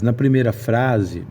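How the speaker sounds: ordinary delivery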